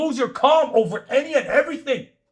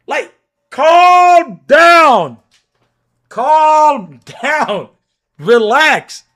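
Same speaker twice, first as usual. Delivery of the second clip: mocking calm down; 6 semitones higher, 10 dB louder, much slower than usual. Mocking the other's temper.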